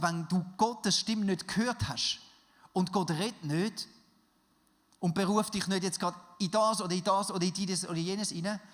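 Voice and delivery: ordinary delivery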